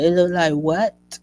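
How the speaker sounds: loud, startled echo question; alarmed disbelief. Retold or imitated. Mother hearing ultrasound news about her baby, alarmed disbelief. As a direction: fling the question back, voice rising in shock.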